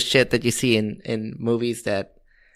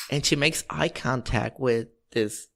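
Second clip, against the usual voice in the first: ordinary delivery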